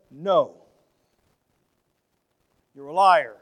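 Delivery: defiant refusal. Retold or imitated. Voicing opponents rejecting God.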